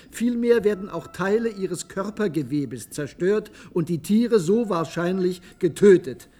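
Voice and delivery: high-pitched, urgent citation; agitated quoting. Acted. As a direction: read the quote like an alarm. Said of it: "Ich zitiere einen gewissen David Day". (aufgebracht zitierend)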